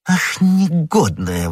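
high, drawn-out outrage; indignant exclamation. A narrator's reading. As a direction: exclaim slowly, scandalised. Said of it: "воскликнул комиссар".

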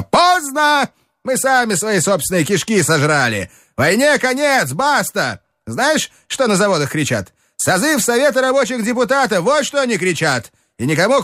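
high, heated tirade; shouting. Acted. Strukov harangues Telegin about the workers, high heated shouting. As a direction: rant it out, voice up high. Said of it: "закричал Струков".

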